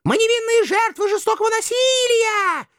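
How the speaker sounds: very high, thin shrieked plea; pleading. A narrator's reading. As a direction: cry out as a wronged maiden. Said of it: "взмолились мокрохвостки".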